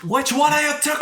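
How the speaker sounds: high, hammed-up fake Scottish accent; bad Scottish accent. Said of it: "that was such a bad accent".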